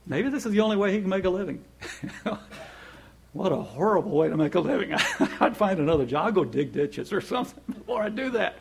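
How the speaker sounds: half-laughing retold self-talk; amused musing. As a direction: chuckle at the thought as you muse aloud. Amused retold musing about caller.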